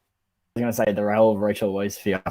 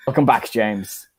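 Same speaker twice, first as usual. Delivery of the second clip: ordinary delivery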